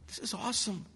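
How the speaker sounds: hushed amazement in worship; awed wonder. Acted. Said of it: "God wants us to just look and say".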